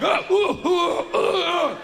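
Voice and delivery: high, loud chain of whoops; bellowing yelps. Retold or imitated. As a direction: bellow wordlessly like a jostled crowd. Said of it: "these bellowing men".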